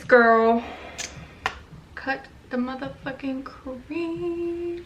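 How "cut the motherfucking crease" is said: slow, hushed emphasis; quiet. Real, not acted. Makeup aside to herself.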